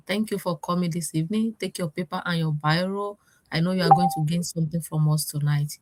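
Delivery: ordinary talk, nothing marked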